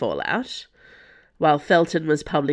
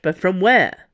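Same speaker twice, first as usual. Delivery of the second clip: sharp, louder question rising at the end; snapped question; 3 semitones higher, 6 dB louder than usual. A narrator's reading. Athos puzzling over the projection's source, snapping. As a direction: snap it, hitting the last word. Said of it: "Athos snapped".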